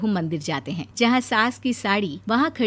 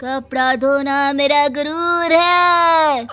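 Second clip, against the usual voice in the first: hammed-up Sunny Deol style film dialogue, slow and higher; filmy dialogue; 7 semitones higher, 3 dB louder, slower than usual. Bride quoting a Sunny Deol film line to her mother-in-law. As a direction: deliver it like a melodramatic movie hero. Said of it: "उसी के dialogue मार रही है".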